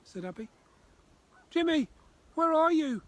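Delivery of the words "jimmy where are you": much higher, much louder frantic call. Acted. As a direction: shout across the marsh. Abby calling for lost Jimmy.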